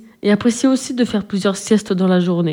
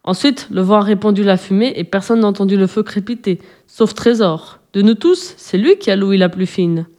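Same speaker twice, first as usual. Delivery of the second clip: ordinary delivery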